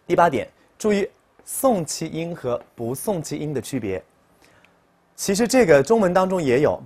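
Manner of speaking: ordinary talk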